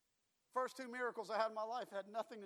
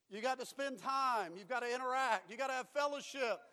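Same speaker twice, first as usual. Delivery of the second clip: loud, punched phrases in a driving list; emphatic pulpit exhortation; forceful preaching; 4 semitones higher, 9 dB louder than usual. Real, not acted. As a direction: drive each point home like a preacher.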